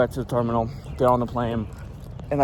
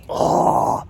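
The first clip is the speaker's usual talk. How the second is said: voiceless huffed "oh", fed up; exasperated breath; 3 dB louder than usual. Retold or imitated. Traveler with nothing in his bag, exasperated huff.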